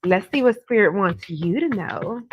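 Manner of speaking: normal talking voice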